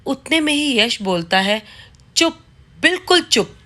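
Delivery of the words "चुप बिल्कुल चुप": husband cutting his wife off in rage; raised voice ordering silence; angry shout. Acted. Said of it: "यश सिमरन पर चिल्लाए ही जा रहा था".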